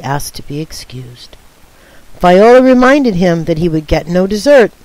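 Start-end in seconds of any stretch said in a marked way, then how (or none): 0.0-1.4 hushed narrator whisper. A narrator's reading. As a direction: breathe the line without voice.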